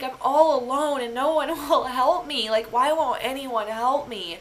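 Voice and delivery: high, plaintive retold lament; self-pitying wail. Driving alone to urgent care, self-pitying plaintive cry. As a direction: draw out the plea, feeling abandoned. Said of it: "I felt so sorry for myself".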